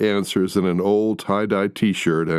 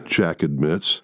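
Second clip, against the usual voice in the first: ordinary delivery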